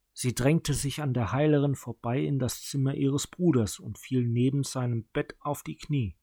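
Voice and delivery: usual speaking voice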